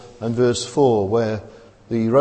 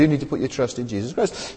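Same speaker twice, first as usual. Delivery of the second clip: normal talking voice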